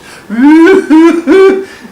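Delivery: loud, high, wailing burst imitating the turtle; wailing cry. The turtle cries for his missus, loud high wail. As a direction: let out the turtle's bawling wail. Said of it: "Started bawling and crying".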